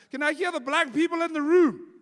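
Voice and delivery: high, loud call to the crowd; rally the room; audience callout shout. Real, not acted. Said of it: "calling out to audience".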